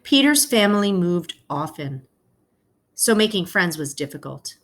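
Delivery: gentle read-aloud to the class; story narration. A narrator's reading. Teacher reading a picture book to her class, storytelling voice.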